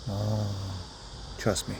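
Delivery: soft low reassurance to a wary bird; very gentle. Real, not acted. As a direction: coax the bird softly. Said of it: "ask you really gently".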